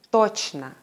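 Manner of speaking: "точно" with a long, clear O at the start; stress on first syllable. Shown as a demonstration. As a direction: stress TOCH in "точно", weaken the ending. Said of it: "Erste Silbe".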